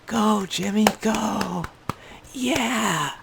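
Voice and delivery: mock cheering parent at a kid's game. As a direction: yell it like a proud parent in the stands. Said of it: "Just being a nice parent".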